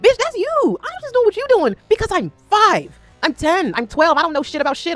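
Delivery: high, loud, exasperated comeback; hammed-up protest of an exasperated kid; child's imagined retort. Child self talking back to mother, exasperated retort. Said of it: "in my mind, I never had the language".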